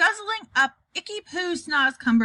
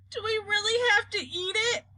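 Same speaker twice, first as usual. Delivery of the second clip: put-on high, crying little-girl plea; girl begging not to eat the foul vegetable, tearful; whimpering plea; 10 semitones higher than usual. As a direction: beg on the edge of tears. Sophie dreading the snozcumber.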